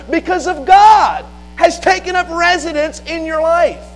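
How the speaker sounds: high, insistent pulpit cadence; impassioned preaching. Real, not acted. Preacher telling the congregation God lives in them, impassioned preaching. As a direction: drive it home, phrase after phrase.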